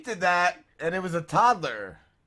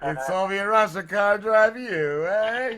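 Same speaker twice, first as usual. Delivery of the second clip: slow mock Russian accent; hammed-up Russian comic delivery, rising to a questioning tag; Yakov Smirnoff; 4 semitones higher, slower than usual. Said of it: "or fucking Yakov Smirnoff".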